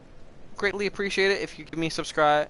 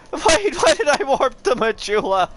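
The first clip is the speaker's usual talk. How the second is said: whiny dismay; 8 semitones higher, 6 dB louder than usual. Real, not acted. Game mistake, whiny cry.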